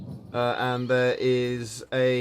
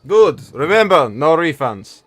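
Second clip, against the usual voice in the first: loud, higher warning: no refunds; stern shopkeeper; 3 semitones higher, 9 dB louder, slower than usual. Acted. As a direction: bark the rule at the customer.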